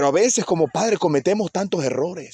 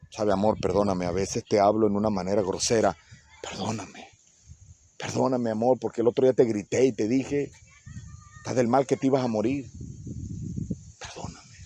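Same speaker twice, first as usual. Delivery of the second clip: lower, softer, intimate plea to a spouse; soft tender apology; 6 semitones lower, 5 dB quieter than usual. Acted. Husband apologizing to his wife for yelling, soft and tender. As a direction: apologize to your love almost in a hush, ending in a whisper. Spouse voiced asking forgiveness.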